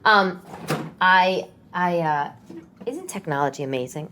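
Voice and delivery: ordinary talk, nothing marked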